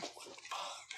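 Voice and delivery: hushed, breathless disbelief; stunned whisper. Retold or imitated. Son reacting to mama's secret, whispered in shock. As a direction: whisper it like you can't believe it.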